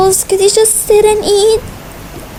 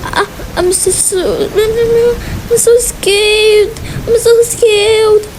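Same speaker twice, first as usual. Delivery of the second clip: pretend crying; 5 semitones higher, slower than usual. A twin sobs to her sister as the waves rise outside. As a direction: cry it out, barely getting the words out.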